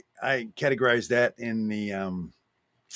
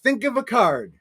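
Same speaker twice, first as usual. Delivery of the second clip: excited mimic; 9 semitones higher, 4 dB louder, faster than usual. Mimicking the magician, amazed.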